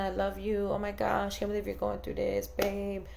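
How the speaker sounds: soft, hushed pretend comfort. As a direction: murmur the sympathy she wishes for. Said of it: "we want the whole, like, sympathy".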